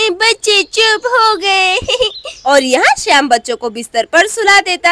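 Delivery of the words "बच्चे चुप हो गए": put-on toddler giggling. Toddler pleased.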